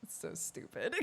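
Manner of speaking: normal talking voice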